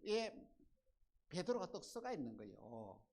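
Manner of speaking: ordinary talk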